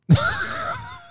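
extreme high shrieking cry of laughter. Real, not acted. Host finds Armand's photo, shrieks with laughter.

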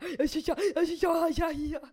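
high, loud stammering "ja, ja"; a mock tumble of "ja, ja, ja", high and loud; rapid stumbling demo. Shown as a demonstration. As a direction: mimic tripping over your own words. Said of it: "niin sit se on ihan semmoista".

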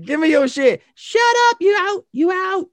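squeaky, shouted imitation of a friend; hammed-up high-pitched yelling impersonation; high mock impression. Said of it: "He's like".

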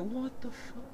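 hushed shocked mutter; stunned under breath. Retold or imitated. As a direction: breathe it out in disbelief. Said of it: "I was like".

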